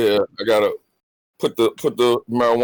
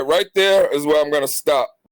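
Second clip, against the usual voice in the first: ordinary talk, nothing marked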